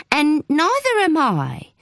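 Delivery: indignant. Acted. Sharon correcting the caller, indignant and slow. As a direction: set him straight, each word firm.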